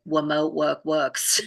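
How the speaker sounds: "Remote work works" with every R softened to a W; childlike R's. Shown as a demonstration. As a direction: say "Remote work works" slowly, like a small child who can't pronounce R. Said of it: "You know how kids talk like that".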